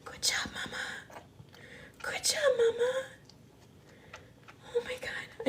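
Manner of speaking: tearful whisper, pitch breaking high; happy tears. Real, not acted. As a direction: choke it out, overcome with joy. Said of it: "I'm shaking".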